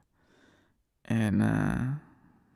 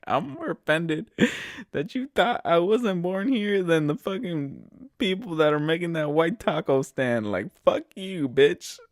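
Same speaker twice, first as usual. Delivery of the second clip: mock indignant retort; 6 semitones higher, 4 dB louder than usual.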